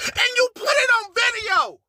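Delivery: high sob-shout with stress on it; crying shout. Real, not acted. To the liar on video, a crying shout. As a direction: wail the accusation through tears.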